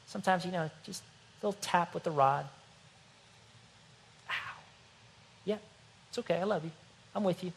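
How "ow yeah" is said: usual speaking voice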